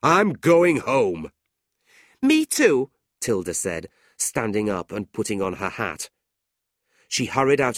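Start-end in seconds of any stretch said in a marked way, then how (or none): 0.0-1.3 loud, slow, raised-pitch outburst; angry shout. A narrator's reading. John banging the table at the quarrel, fed-up shout. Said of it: "he shouted". 2.2-2.9 high, loud huff; angry. A narrator's reading. Tilda storming out with John, huffy.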